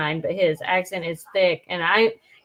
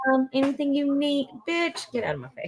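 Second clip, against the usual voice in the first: two-faced sweet then nasty; 5 semitones higher, 4 dB quieter than usual. Retold or imitated. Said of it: "You can say".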